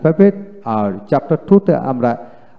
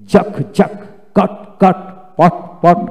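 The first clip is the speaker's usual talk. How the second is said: each word punched out higher, with short gaps; jolting word drill; 3 semitones higher than usual. Shown as a demonstration. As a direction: jolt every repeated word like a drill. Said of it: "এইভাবে জাকি দিয়ে দিয়ে তাকে পড়াবেন".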